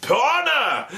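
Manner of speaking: high, hammed-up heroic cry; mock-noble commander calling out; Napoleon. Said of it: "Napoleon, for no reason, gets off his horse".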